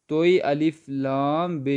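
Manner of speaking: ordinary talk, nothing marked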